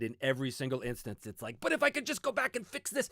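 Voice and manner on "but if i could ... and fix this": much higher-pitched mock desperation; mock frantic pleading. Creator itching to fix finished work, mock frantic pleading. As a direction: plead in a high, put-on voice. Acting out a creator's urge to tinker.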